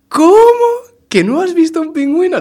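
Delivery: high, loud, incredulous squeal; shocked disbelief. Acted. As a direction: gasp it out as if it can't be true. Shocked son in the joke.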